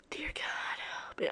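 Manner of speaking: breathy whisper of dread. Real, not acted. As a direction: whisper in horror. Dread at the story.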